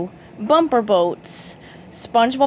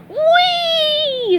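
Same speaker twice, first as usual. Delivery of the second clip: very high, drawn-out whoop; gleeful yell; 4 dB louder than usual. Acted. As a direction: ride the thrill. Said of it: "SpongeBob yelled".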